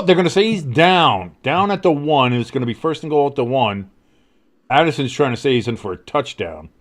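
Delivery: live sports call, rising on the throws; announcer calling the drive; play-by-play. Acted. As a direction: call the game like a broadcaster.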